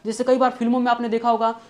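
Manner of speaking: ordinary delivery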